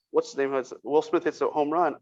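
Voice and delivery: ordinary talk, nothing marked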